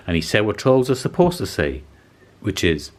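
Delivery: plain reading voice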